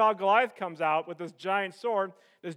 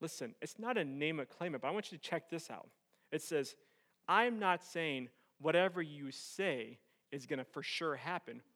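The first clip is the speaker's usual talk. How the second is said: solemn hushed preaching; 9 semitones lower, 9 dB quieter than usual. Real, not acted. Preacher telling congregation the battle is the Lord's, solemn hush. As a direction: bring it right down, quiet and weighty.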